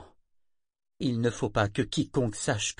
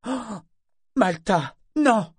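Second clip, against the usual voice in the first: high, loud cry of dismay; atterrée; 12 semitones higher, 8 dB louder, slower than usual. A narrator's reading. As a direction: exclaim as an appalled mother. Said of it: "s'exclama sa mère atterrée". (exclamation de mère consternée)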